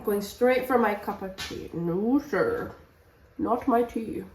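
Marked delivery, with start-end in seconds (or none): none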